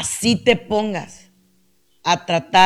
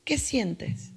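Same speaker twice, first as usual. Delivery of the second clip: near-silent question; 11 dB quieter than usual. Acted. Repeats the question even softer.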